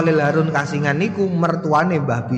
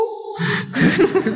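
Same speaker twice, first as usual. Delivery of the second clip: laughing at own joke. Real, not acted. Laughs after own mimicry.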